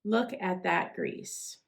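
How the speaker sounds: ordinary talk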